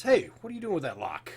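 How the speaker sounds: guard demanding an explanation; challenging guard. Acted. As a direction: call out like a guard catching someone. Suspicious guard calls out to player.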